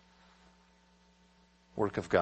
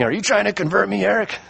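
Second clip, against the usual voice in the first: questioning skeptic; 10 semitones higher than usual. Retold or imitated. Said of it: "I've had people say to me".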